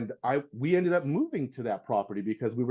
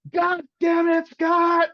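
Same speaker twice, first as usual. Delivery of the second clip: much higher and louder, hammed-up angry father; furious dad yelling; 14 semitones higher, 6 dB louder than usual. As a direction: bellow it like an enraged dad firing his hungover son. Angry dad fires his son.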